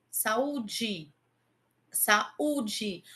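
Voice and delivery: saúde with a wide-open a, then closing on u; Saúde, a and u in separate syllables; sa-ú-de, mouth open then closing; open then close the mouth. Shown as a demonstration. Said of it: "Observe que eu abro a boca e fecho a boca".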